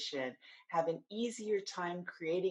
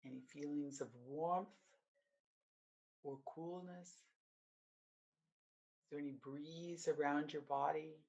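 guided meditation; 2 semitones lower, 4 dB quieter than usual. Acted. Coach leading listeners through mindfulness of the body, guided meditation. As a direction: guide them gently through the body, unhurried, settling softer.

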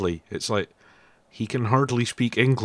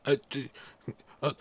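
higher, halting imitation of a pleading caller; lightly voiced flustered caller, pitched up; imitated call-centre caller; 6 semitones higher than usual. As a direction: stumble through a mock caller's objection. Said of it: "he's like".

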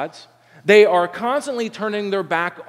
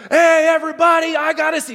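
put-on much higher and louder, a little boy blurting it out; excited kid shout; 11 semitones higher, 7 dB louder than usual. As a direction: shout the secret to the whole house. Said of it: "he's not a very good secret keeper".

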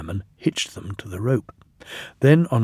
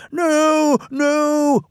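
very high, louder drawn-out wail; 5 dB louder than usual. A narrator's reading. Empty trucks try to stop the loaded ones, wailing. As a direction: wail in hammed-up alarm. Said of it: "wailed the empty trucks".

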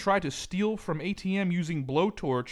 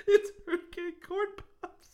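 laughing. Real, not acted. Repeating joke while laughing.

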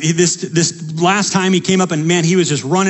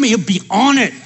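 usual speaking voice

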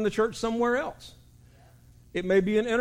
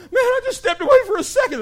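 mock crying; 8 dB louder than usual. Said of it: "He said".

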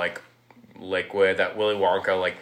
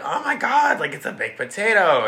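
put-on much higher, squealing girly voice; gushing girl voice; 11 semitones higher, 3 dB louder than usual. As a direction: squeal like a bratty little girl tasting dinner. Violet tasting the magic gum.

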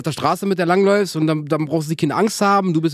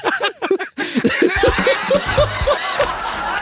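high-pitched laughing fit; helpless laughter. Real, not acted. Podcast host cracking up at his own joke, helpless laughter.

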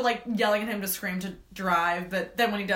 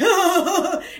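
high, loud deranged cackle; imitated maniacal cackle; 10 semitones higher, 10 dB louder than usual. Imitating the hitchhiker slashing at her, loud maniacal cackle. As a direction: cackle like a crazed attacker. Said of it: "because he's just like".

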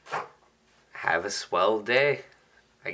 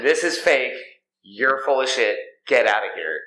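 louder, higher retold telling-off; angry shout; 3 semitones higher, 5 dB louder than usual. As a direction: yell it like you're calling out a scammer. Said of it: "He just lays into the guy".

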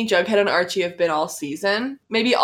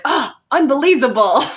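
mock exasperation; 5 semitones higher, 6 dB louder than usual. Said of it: "And he's like".

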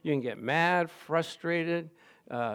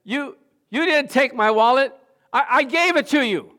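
higher, louder call after a thief with a laugh; Smith Wigglesworth; 9 semitones higher, 9 dB louder than usual. Retold or imitated. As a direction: shout after the runner, half laughing. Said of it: "And he said".

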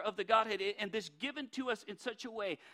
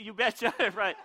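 laughing you betcha; 9 dB louder than usual. Shown as a demonstration. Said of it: "feel free to let out a you betcha".

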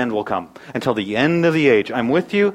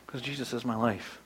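hushed sincere; 5 semitones lower, 15 dB quieter than usual. Real, not acted. Quiet personal confession.

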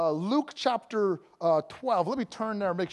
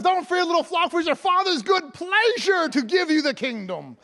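high, emphatic preaching; fervent proclamation; 8 semitones higher, 4 dB louder than usual. Acted. Preacher proclaims the whole verse to congregation, high fervent delivery. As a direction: declare it as glorious good news.

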